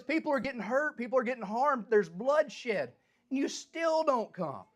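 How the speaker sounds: high, pressing accusation, slowing at the end; angry accusation. Acted. Habakkuk accusing God of not coming despite bloodshed, high and indignant. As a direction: throw the charge at God in frustration. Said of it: "He was angry".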